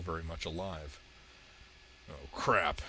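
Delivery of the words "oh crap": low-pitched, resigned mutter; muttered curse. A narrator's reading. Hellboy curses as the monster survives, low mutter. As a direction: mutter it low, but still audible. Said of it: "Hellboy muttered".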